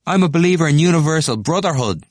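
an excitable student preaching brotherhood; fervent declaration. Acted. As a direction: proclaim it to the ring.

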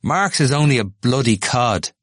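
a hothead sneering at Marx; heated scoff. Acted. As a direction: spit out the scorn. Temple rants.

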